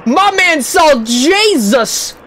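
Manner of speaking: slow, drawn-out, emphatic amazement; amazed exclamation. Real, not acted. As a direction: stretch each word, leaning on Jesus. Exclaiming over a near-death clip.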